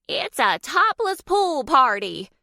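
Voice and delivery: high, loud, gleeful shout; excited shout. A narrator's reading. Graham cheering as she jumps into the pool, shouting. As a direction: whoop it out slowly with delight. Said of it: "Graham shouted".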